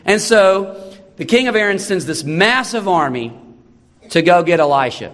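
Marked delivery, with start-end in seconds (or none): none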